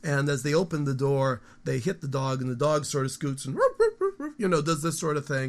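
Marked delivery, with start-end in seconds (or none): 3.6-4.3 startled dog barking. Retold or imitated. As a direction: yap like a dog that just got hit by the door. Startled dog barks at owner.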